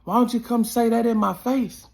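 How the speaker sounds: angry street yell; retold yell. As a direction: shout it across the street, daring him. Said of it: "I yell".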